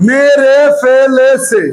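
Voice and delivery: মেরে ফেলেছে very slow, syllable by syllable; drawn-out wrong vowels. Shown as a demonstration. Teacher showing the class what results, slow syllabled মেরে ফেলেছে. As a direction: stretch each short E in মেরে ফেলেছে. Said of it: "তাই তো হচ্ছে".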